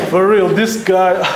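ordinary talk, nothing marked

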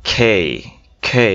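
খে as "khay", airy burst; strong puff and long glide on খে; correct aspirated K. Shown as a demonstration. Teacher modelling it for practice, aspirated খে. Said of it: "এটা হচ্ছে".

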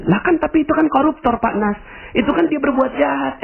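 very high-pitched objection; mock protest. Listener objects to the preacher about a corruptor, protesting.

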